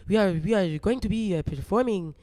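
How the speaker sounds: put-on French accent; halting, amateur French accent. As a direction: attempt a playful French accent. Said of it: "I can only do a French accent".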